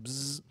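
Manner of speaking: sustained buzzing noise; imitated buzz. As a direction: mimic a steady hum in the ears. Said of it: "that, that buzz that comes in".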